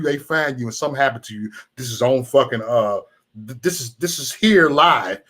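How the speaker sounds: normal talking voice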